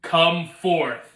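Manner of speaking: loud, high, drawn-out shout; commanding call. Acted. Jesus calling Lazarus out of the grave, commanding shout. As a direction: summon him with a booming, stretched call. Jesus calls the dead man out.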